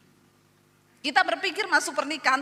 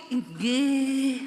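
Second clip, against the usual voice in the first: submissive Javanese mother; 4 dB louder than usual. Retold or imitated. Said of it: "maknya ngomong".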